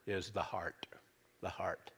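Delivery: whisper. Real, not acted. Pastor whispers to the congregation that the key word is the heart.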